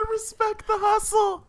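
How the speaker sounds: squeaky high mock voice, far off her own; mocking imitation. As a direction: parrot the line in a silly high put-on voice. Mocking a friend's line.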